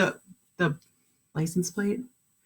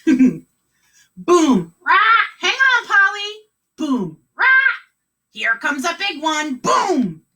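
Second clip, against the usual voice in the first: higher, loud bouncing cries; bumpy ride exclamations; 8 semitones higher, 5 dB louder, slower than usual. Acted. Pirate driver hits potholes on the bus. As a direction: call out each bump.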